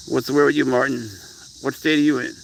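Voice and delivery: normal talking voice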